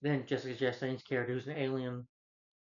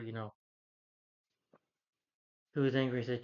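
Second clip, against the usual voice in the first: ordinary delivery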